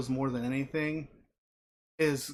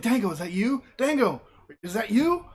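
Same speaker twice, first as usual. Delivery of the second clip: higher-pitched mock urgent call; 7 semitones higher, 4 dB louder than usual. Tyler Breeze calling to Fandango, urgent. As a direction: call to him like you've found your missing friend. Acted skit, anxious calling to partner.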